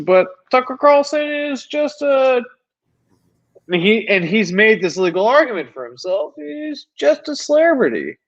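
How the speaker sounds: heated rant. Real, not acted. Heated rant, pitch raised.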